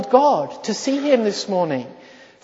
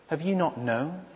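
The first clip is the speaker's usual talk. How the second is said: softer, inward asking of the question; hushed question; 2 semitones lower, 9 dB quieter than usual. Shown as a demonstration. Preacher quietly posing Isaiah's question, hushed. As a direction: murmur it as if to yourself. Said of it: "repeated question, hushed then raised".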